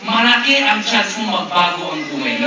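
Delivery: usual speaking voice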